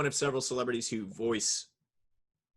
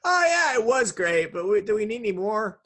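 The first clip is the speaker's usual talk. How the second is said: high, loud mock-excited gush; 10 semitones higher, 6 dB louder than usual. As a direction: gush like an overexcited fan, then doubt it. Mock fan gushing.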